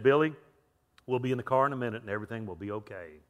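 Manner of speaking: calm reassurance, dropping low and soft; very calm dad. Retold or imitated. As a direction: imitate a father soothing through gritted patience. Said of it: "and very calmly just said".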